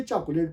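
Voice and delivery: चॉकलेट in three syllables, "cha-ko-layt"; "chak-o-LAYT" for चॉकलेट; spelling pronunciation. Shown as a demonstration. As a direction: read चॉकलेट off the spelling with the middle vowel sounded. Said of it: "spelling को लेके पढ़ेंगे तो".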